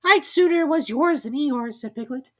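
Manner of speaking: high, timid little voice; squeaky piglet voice; small Piglet. A narrator's reading. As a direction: speak up small and worried.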